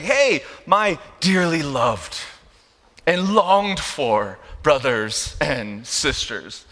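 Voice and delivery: slow, lingering, laid-on-thick endearment; awkwardly effusive greeting. Acted. Preacher as Paul buttering up the believers, slow and effusive. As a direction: draw out each term of endearment. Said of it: "very awkward and different way".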